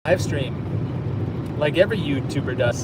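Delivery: talking while brushing teeth; words through a toothbrush. Real, not acted. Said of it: "I'm just brushing my teeth".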